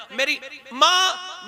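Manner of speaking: very slow pleading call; drawn-out call. Acted. Son pleading with his mother, slow drawn call. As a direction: stretch the words out, begging. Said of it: "आवाज देगा".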